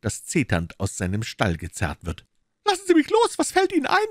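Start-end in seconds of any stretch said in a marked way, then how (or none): 2.6-4.0 very high, loud shriek of protest. Acted. Maid shrieking at Holmes as he drags her in, very high and loud. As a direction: screech it like a dragged-off hen. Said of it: "kreischte sie".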